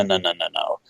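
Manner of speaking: the last no, no, no, no dropping low and soft; trailing off into a soft, low murmur; fading no. Retold or imitated. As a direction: let the refusal die away under the breath. Trailing repeat of refusal.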